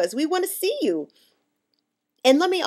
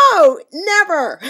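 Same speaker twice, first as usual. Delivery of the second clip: emphatic no; 8 semitones higher, 9 dB louder, slower than usual. Acted. Scoffing answer to own question.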